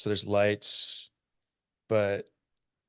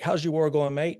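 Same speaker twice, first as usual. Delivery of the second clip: bright, higher Australian teasing question; Australian accent; 6 semitones higher than usual. Retold or imitated. Aussie teases the idle Rangers, in a cheery Australian accent. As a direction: ask it like a smug Aussie mate. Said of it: "They're like".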